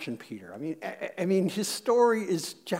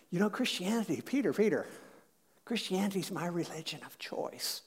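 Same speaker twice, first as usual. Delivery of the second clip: soft, self-satisfied declaration; mock-sincere, hushed announcement; earnest confiding; 12 dB quieter than usual. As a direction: confide it gently, as a considered choice. Churchgoer earnestly confides in Peter.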